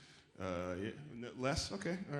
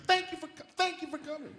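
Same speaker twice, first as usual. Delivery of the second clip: high, pushy "thank you"; aggressive thank-you. Retold or imitated. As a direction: thank him like it's a demand. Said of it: "very sort of aggressive".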